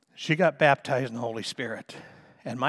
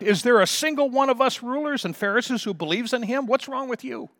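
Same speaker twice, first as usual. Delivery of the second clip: much higher, scornful mocking taunt; 10 semitones higher, 7 dB louder than usual. Pharisees scorning guards for believing in Jesus, high mocking. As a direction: ridicule them from up high. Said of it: "retorted back in a mocking tone".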